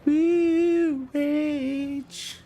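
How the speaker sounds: very high, drawn-out mock rage cry; playful whoop. Table cheers on the rage move, playful high whoop. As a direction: whoop it in a squeaky falsetto.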